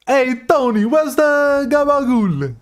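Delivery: high, drawn-out Italian-American movie accent. Shown as a demonstration. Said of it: "not use too much Italian-American accent".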